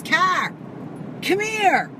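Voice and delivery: high, loud calling out; urgent call. Retold or imitated. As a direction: summon her over. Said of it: "Shawnee goes".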